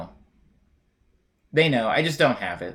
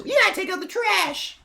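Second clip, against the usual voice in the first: much higher nagging wife voice; sitcom wife. Retold or imitated. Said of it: "the wife comes in and she says".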